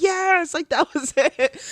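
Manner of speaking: high, bright burst of retold joy; excited. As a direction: relive the eureka moment with a high squeal. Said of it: "I'm so excited".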